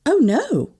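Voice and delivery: flustered, pitched high; taken aback, mild alarm; stammering. A narrator's reading. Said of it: "I stammered".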